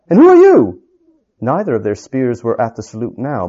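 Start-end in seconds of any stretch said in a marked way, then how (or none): 0.1-0.8 much higher, louder demanding challenge; suspicious challenge. Acted. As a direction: bark the question at the stranger. Soldier challenges stranger.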